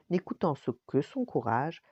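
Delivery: plain reading voice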